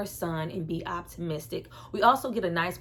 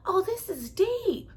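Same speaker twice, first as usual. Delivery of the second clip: awed; 11 semitones higher, slower than usual. Retold or imitated. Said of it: "I was like".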